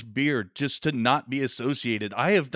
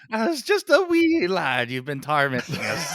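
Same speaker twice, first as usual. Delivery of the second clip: put-on Irish accent; 3 semitones higher, 5 dB louder than usual. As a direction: put on a leprechaun brogue. Teasing co-host about Irishmen.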